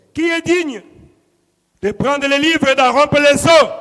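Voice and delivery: high, loud challenge to all; ringing scriptural summons; preacher's proclamation. Acted. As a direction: proclaim the question with full pulpit force.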